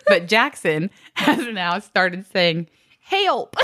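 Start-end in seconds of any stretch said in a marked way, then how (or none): 3.0-3.6 put-on high twangy kid cowboy; little cowboy voice. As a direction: imitate a toddler cowboy. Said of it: "he sounds like a little cowboy".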